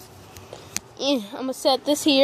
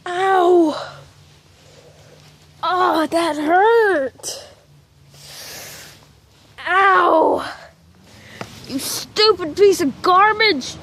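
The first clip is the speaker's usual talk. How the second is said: angry outburst; 4 semitones higher, much slower than usual. Real, not acted. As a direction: snap in real pain and irritation. In pain, cursing the sign.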